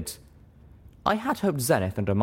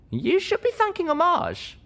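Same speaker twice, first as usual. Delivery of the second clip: high voiced quote. Narrator voicing herself to Zenith.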